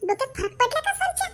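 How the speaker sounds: high, outraged disbelief; can't believe what the boss did; shocked disbelief. Acted. Shocked at huge workload. (धक्का बसून अविश्वासाने)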